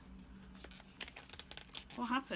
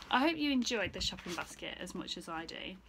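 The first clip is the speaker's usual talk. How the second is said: plain reading voice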